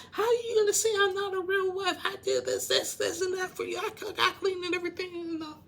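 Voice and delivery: mimicked yelling rant; high, rapid-fire indignant tirade; Rebecca. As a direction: act out yelling at a husband. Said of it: "she goes back yelling at Ziad".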